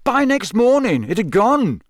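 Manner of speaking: high, exasperated explanation; impatient. A narrator's reading. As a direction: spell it out impatiently, pitch leaping up. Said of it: "said Fred impatiently".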